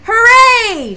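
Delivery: Moosling cheering at winter fun, loud and high; joyful shout. A narrator's reading. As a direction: shout it out with pure delight. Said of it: "shouted Moosling".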